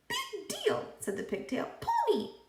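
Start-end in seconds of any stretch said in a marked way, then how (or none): 0.1-0.9 high, squeaky talking pigtail. A narrator's reading. 1.8-2.4 high, squeaky talking pigtail. A narrator's reading.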